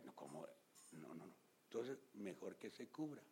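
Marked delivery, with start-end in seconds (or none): 0.0-1.3 much lower, softer horrified denial; understated scandalized refusal; hushed shocked no. Retold or imitated. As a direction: recoil quietly in shock. Shocked church reaction retold.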